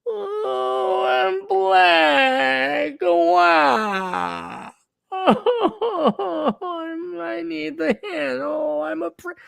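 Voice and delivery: high, drawn-out mock wailing; hammed-up crybaby sobbing through the words; whiny whiner. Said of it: "whiny little Democrat whiner".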